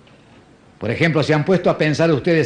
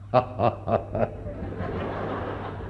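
breathy laugh; 6 semitones lower, 11 dB quieter than usual. Real, not acted. Punchline laugh.

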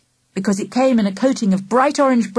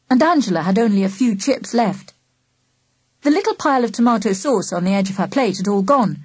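ordinary delivery

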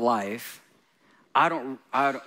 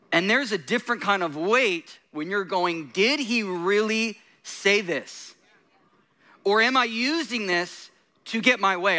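raised, driving preacher voice; fiery preaching; 5 semitones higher than usual. Acted. Pastor rebuking casual prophecy, fiery preaching. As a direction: preach it high and hard to the congregation.

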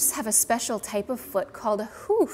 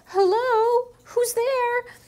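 high, loud call down a hole; 13 semitones higher, 5 dB louder, much slower than usual. Acted. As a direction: shout down into the dark den. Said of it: "he said".

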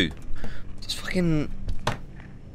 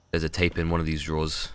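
ordinary talk, nothing marked

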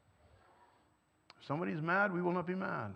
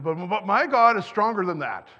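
put-on louder, firm child's declaration; little girl's declaration; 9 dB louder than usual. Granddaughter insists to grandpa, loud and firm. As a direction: proclaim it like a sure little girl. Granddaughter quoted during video.

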